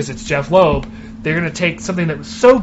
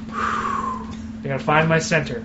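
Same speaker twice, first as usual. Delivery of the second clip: calming himself; 7 dB quieter, slower than usual. Real, not acted. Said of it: "Mad. Frothing".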